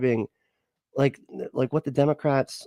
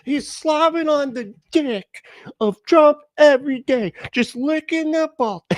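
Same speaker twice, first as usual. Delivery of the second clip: high mock-disgusted girlfriend voice, louder; hammed-up grossed-out girlfriend; imitating girlfriend; 4 dB louder than usual. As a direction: squeal it the way she complains. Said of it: "She's like".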